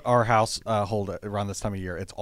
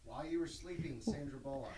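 very quiet off-mic prompt; feed the answer softly from a distance; off in the corner; 4 semitones higher, 18 dB quieter than usual. Real, not acted. Director prompting the cast from the corner, very quiet and distant. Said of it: "like God off in the corner".

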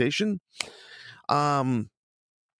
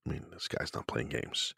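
hushed aside; 10 semitones lower, 12 dB quieter than usual. Real, not acted. Reacting to a blunt question.

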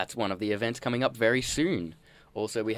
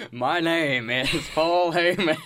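Paul Heyman impression; 8 semitones higher, 6 dB louder than usual. Imitating Paul Heyman laughing.

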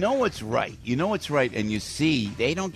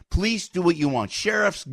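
normal talking voice

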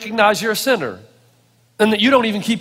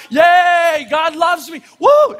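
ecstatic whoop; 6 semitones higher, 4 dB louder, much slower than usual. Retold or imitated. Dancing around the car in traffic.

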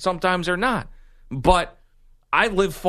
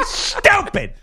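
high angry shout, higher and louder; angry voicemail; 4 dB louder than usual. Retold or imitated. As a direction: snap the insult. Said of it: "leaving angry voicemails to her".

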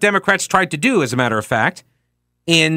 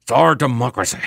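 lightly voiced grave statesman, jaw out; Winston Churchill; 3 semitones lower than usual. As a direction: say it with weighty gravitas and an underbite. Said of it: "in my best Winston Churchill voice".

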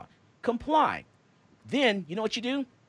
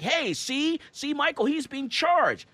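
mock gloating caller; 10 semitones higher, slower than usual. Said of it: "They're like".

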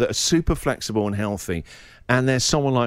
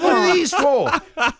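much higher, slow, laughing disbelief; mock bewilderment; slower than usual. Handed extra straps by the teacher, high slow disbelief. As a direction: hold up the straps, baffled.

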